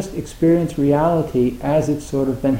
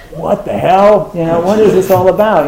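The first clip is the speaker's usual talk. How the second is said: loud, higher baffled protest; acted exasperation; 5 semitones higher, 7 dB louder than usual. Beginner on the cushion questioning the practice, mock exasperated. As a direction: blurt it like a fed-up beginner.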